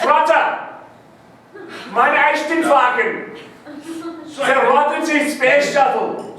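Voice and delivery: high, drawn-out mock-German shouting. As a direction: declaim slowly in exaggerated German. Shouts German to dad on speakerphone.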